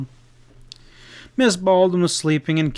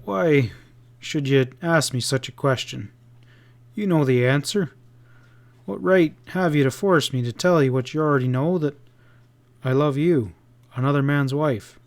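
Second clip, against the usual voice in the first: usual speaking voice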